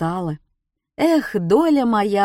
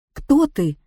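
startled, high and louder; frightened; 7 semitones higher, 3 dB louder than usual. A narrator's reading. Woman startled by the hag, frightened cry. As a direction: jump back in fright. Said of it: "испуганно отшатнулась женщина".

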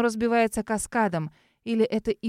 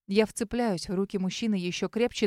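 ordinary delivery, nothing marked

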